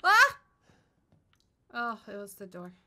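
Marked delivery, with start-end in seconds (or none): 0.0-0.4 high loud scream of fright; real jump scare scream; startled scream. Real, not acted. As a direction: shriek at the sudden noise.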